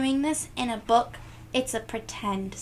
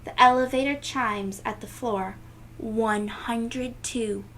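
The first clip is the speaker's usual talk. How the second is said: usual speaking voice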